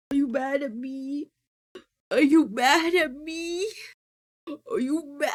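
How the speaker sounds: hammed-up weepy pleading; mock whiny plea. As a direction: whine it like a hurt child.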